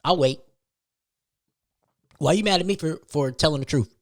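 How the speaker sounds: normal talking voice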